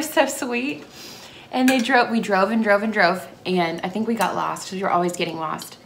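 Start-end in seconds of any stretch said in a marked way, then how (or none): none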